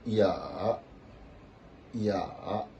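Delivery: ya dipping, then rising; third tone. Shown as a demonstration. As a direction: draw "ya" down and back up. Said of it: "ça va faire quoi".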